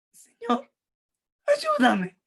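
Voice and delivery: the kneeling woman crying out to Jesus for help; tearful plea. Shown as a demonstration. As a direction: beg through tears, voice straining up. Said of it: "yo puedo llorar, aquí tengo agüita".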